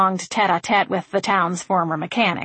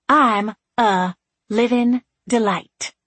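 slow, each word leaned on; emphatic repeat; 3 semitones higher, much slower than usual. A narrator's reading. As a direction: insist on it slowly. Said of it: "Frankie reiterated".

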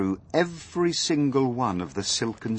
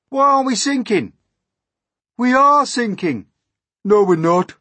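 high, raised voices in dispute; loud bickering; 12 semitones higher, 9 dB louder than usual. A narrator's reading. Anxious passengers dispute whether the peach sinks, loud bickering. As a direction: argue it back loudly.

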